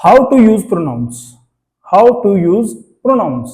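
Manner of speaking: slow, drawn-out heading for the board; teacher dictating the lesson title; slow topic heading. Shown as a demonstration. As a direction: announce the topic slowly, word by word. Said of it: "announcing the new topic".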